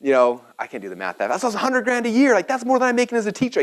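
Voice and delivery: high, excited rush, imitating an amazed teacher; hammed-up thrilled professor raving to the class; teacher's excited outburst, pitched high; freaking out excitedly. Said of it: "He's freaking out".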